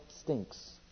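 stinks said softly, almost breathed; dropped, blunt word. Acted. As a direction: let "stinks" fall low and quiet. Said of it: "may I use this term".

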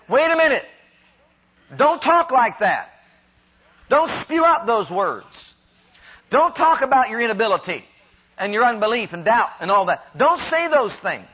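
imitated firm rebuke, pitch jumping up on the commands; acted admonishing. Preacher as Caleb stilling the people, emphatic admonishing. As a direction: hush the complainers with emphatic don'ts. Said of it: "I'm sure that Caleb probably said".

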